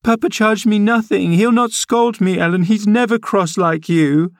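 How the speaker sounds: put-on high girlish voice, pouting and defiant; a spoiled child talking back; defiant young girl. A narrator's reading. Girl Cathy answers her nurse.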